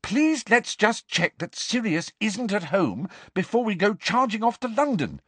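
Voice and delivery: high, earnest begging; urgent, high-pitched bargaining; desperate pleading. A narrator's reading. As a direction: plead with him to wait. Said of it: "said Hermione desperately".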